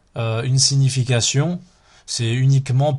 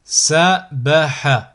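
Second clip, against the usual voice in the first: slow, each syllable drawn out separately; split into syllables; 4 dB louder, much slower than usual. Shown as a demonstration. Teacher models a word for learners to repeat, split into syllables. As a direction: cut the word up, pausing between syllables. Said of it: "décomposer le mot".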